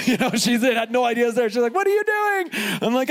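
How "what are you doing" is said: very high-pitched mock shriek of surprise; imitated high, astonished squeal; startled surprise. Surprised wife's reaction, retold.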